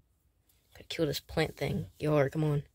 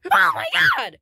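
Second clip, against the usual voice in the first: startled shout; 3 semitones higher, 10 dB louder than usual. Acted. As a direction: jump at the fright. Reacting to a scare.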